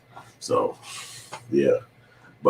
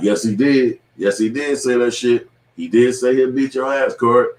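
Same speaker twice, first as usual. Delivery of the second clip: raised-pitch imitation of a chorus insisting; insistent contradiction; 7 semitones higher than usual. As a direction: act out the room setting Corey straight. Acting out the room contradicting Corey.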